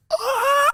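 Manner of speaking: high-pitched effort cry; imitated squeal of exertion; straining yell. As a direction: strain hard against a bear hug. Acts straining to break free.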